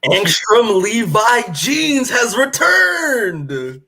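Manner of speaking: slow, high, loud announcer hype; dramatic villain-return announcement. Acted. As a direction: boom it like a trailer voiceover.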